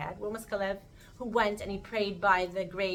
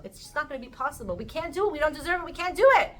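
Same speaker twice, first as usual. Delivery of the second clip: high insistent protest building louder; hyping themselves up; 7 semitones higher, 5 dB louder, faster than usual. Acted. Scouts convincing themselves they can't go in, worked up. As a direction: climb in pitch and force to the last refusal. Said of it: "Hyping themselves up in their minds".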